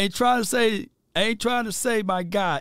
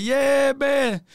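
excited shout; 8 semitones higher than usual. Retold or imitated. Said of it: "everybody was sitting there like".

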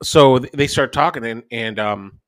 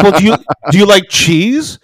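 higher, stammered, disbelieving question; retold astonishment; 6 semitones higher, 4 dB louder than usual. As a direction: play a floored kid grasping for an explanation. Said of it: "almost hit the floor".

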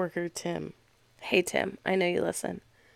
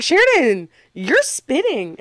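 high, loud imitated complaint; mock scolding; 6 semitones higher, 7 dB louder than usual. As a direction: call out a drawn-out reproach. Coworkers' reproach acted out.

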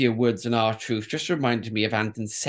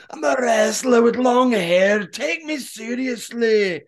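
higher, louder Scottish voice; mock Scottish wrestler; 8 semitones higher, 4 dB louder, slower than usual. Host voicing Drew McIntyre, mock Scottish accent. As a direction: play the long-haired wrestler begging respect.

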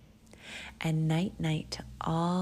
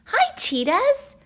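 very high, loud little Albie; eager greeting; 11 dB louder than usual. Acted. Albie hailing the cheetahs, high eager call. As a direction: call out brightly as an excited child.

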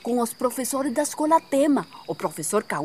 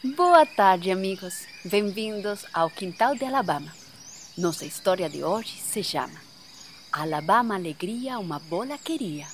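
put-on children's story narration; 5 semitones lower, 5 dB quieter than usual. A narrator's reading.

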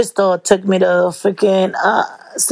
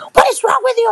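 much higher, exasperated demand; exasperated daughter; 14 semitones higher, faster than usual. Retold or imitated. Daughter scolding her mom for staring, exasperated. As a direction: scold her like an embarrassed teen.

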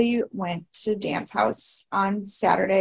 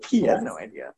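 much lower, mock deep voice; deep put-on voice; 11 semitones lower than usual. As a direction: drop the voice way down for the joke. Repeats the line in a put-on voice.

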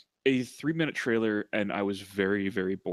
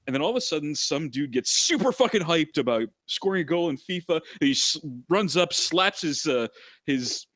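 excited; 6 semitones higher, 4 dB louder than usual. Real, not acted. Excited retelling of trailer.

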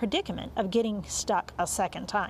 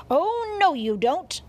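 high, loud shriek of protest; 6 semitones higher, 6 dB louder, slower than usual. A narrator's reading. Cat pouncing on the bunny at the table, shrieking. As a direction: shriek it to stop him. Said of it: "he shrieked".

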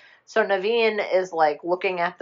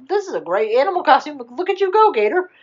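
high, quick excited praise; mock party-guest gushing; gushing admiration; 9 semitones higher, 3 dB louder, faster than usual. Guests praise the gator's costume, high excited gushing.

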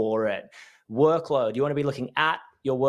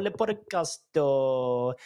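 mock French; much slower than usual. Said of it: "depending on what your accent is".

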